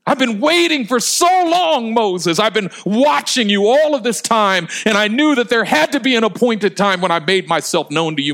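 high, loud, urgent preacher's cry, easing at the end; God's impassioned plea. Acted. Preacher voices God finally revealing himself to Moses, impassioned pleading. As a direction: plead with mounting longing, stretching the name. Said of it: "as if God was saying".

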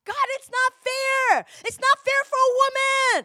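furious prayer to God; angry at God. Retold or imitated. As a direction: shout your complaint at God. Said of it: "so angry at God".

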